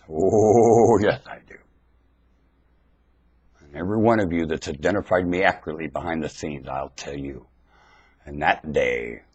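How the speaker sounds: ordinary talk, nothing marked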